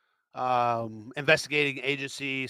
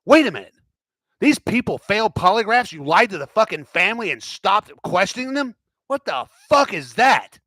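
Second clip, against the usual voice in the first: loud, higher, outraged yelling; outraged shouting newsroom; 8 semitones higher, 7 dB louder than usual. Retold or imitated. Newsroom yelling at the officials who lied, outraged. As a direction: shout it like the newsroom caught them lying.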